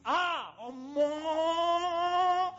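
drawn-out loud ah omo; loud exclamation. Retold or imitated. Groom on wedding night, long loud exclamation.

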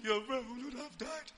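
voiced weeping plea; crying. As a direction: grieve as you plead. Mourner addresses Jesus.

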